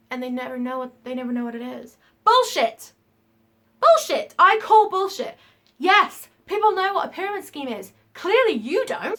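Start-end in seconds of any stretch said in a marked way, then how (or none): 2.2-9.2 loud, high, furious call-out; angry. Real, not acted. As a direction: let the anger boil over. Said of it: "getting a bit condescending, a bit angry".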